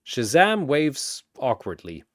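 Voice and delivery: ordinary delivery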